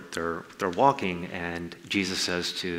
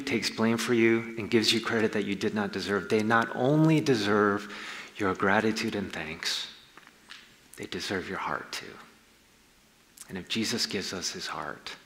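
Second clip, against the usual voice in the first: usual speaking voice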